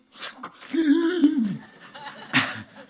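high breathy burst of laughter; laughing hard; real laugh. Real, not acted. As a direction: laugh in disbelief. Laughing at own surprise.

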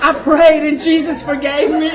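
put-on blurting out the boy's excuse through laughter; laughing little-boy plea. Mother voicing her young son claiming Jesus forgave him. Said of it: "he's like".

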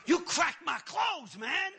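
high, loud, slow mock outrage; steaming mad. Man protesting to the pastor who lifted his hands, mad. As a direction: protest in comic indignation. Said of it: "Now I'm steaming mad".

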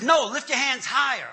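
high, loud mock command; insistent preacher impression. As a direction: push the order like a zealous pastor.

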